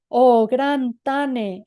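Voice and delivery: slow, loud awed exclamation; loud, drawn-out exclamation; exclaiming. A narrator's reading. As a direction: cry it out, drawing the words long. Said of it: "exclamó".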